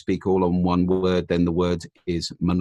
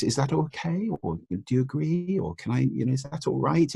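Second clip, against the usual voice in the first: rising questioning tune on "okay" and "right", higher and softer; uptalk demo; 7 semitones higher, 4 dB quieter than usual. Shown as a demonstration. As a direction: end each phrase deferentially up. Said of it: "elided in there is the phrase".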